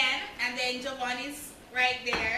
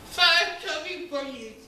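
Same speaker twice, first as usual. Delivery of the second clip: talking through marshmallows; mouth full; 3 dB louder than usual. Real, not acted. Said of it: "and then you put them in your mouth".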